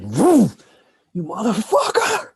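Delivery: loud wild whoop and curse; mock battle cry. Speaker imagining charging the neighbor, loud mock battle cry. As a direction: charge in yelling. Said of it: "I'm like".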